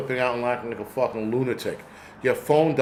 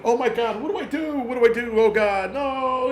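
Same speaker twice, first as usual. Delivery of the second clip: much higher, hammed-up panicked cries; mock panic; 9 semitones higher than usual. Firefighter pretending to panic in a fire, hammed-up high cries. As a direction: play a firefighter losing it in a fire, pitch shooting up. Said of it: "I start panicking".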